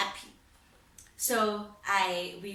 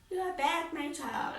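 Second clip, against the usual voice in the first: high, emotional grandmother voice; grandmother's tearful welcome; 8 semitones higher than usual. Retold or imitated. Grandmother greeting her returning grandchild, high and tearful. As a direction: voice her joy, pitched up.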